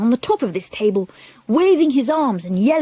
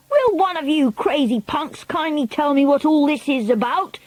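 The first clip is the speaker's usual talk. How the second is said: shouting; 6 semitones higher than usual. A narrator's reading. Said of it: "shouted Bruno".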